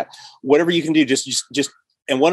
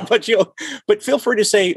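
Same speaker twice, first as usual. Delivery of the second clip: laughing; 8 semitones higher than usual. Real, not acted. Laughing while giving advice.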